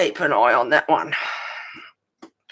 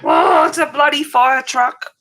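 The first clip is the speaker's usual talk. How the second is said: alarmed; 8 semitones higher, 5 dB louder than usual. Real, not acted. Fire truck heard nearby.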